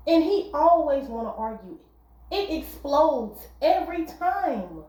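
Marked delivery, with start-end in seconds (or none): none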